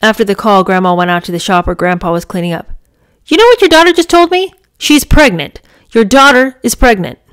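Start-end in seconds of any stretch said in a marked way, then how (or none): 3.3-7.2 agitated. Acted. Grandma tells grandpa news.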